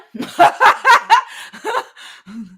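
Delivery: a big mocking cackle, high and loud. Real, not acted. Streamer cackling at upset commenters, loud. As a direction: laugh right in their faces.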